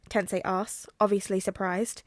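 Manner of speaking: ordinary delivery, nothing marked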